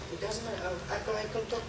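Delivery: ordinary talk, nothing marked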